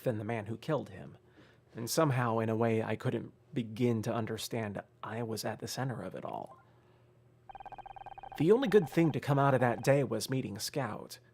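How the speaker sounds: ordinary delivery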